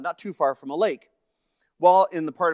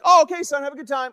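high, loud reply down the stairs; calling back; 12 semitones higher, 7 dB louder, faster than usual. Retold or imitated. Dad sends son off to play hockey, calling back high. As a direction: holler back to your son, imitating an easygoing send-off.

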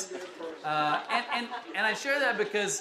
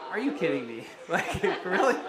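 laughing disbelief. Retold or imitated. Telling the congregation about the DMV, laughing in disbelief. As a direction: laugh it off, can't believe it. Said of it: "And I'm like".

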